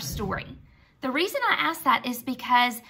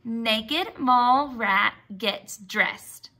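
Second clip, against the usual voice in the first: slow choral reading; much slower than usual. Shown as a demonstration. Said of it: "Can you say that with me?".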